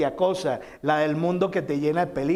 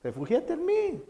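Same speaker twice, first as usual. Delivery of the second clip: high, soft and tender, drawing them in; tender invitation; 10 semitones higher, 5 dB quieter than usual. Acted. Preacher voicing the Lord inviting the frightened listener to shelter, high and soft. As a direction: coax gently like a loving father calling. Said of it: "no, te dice".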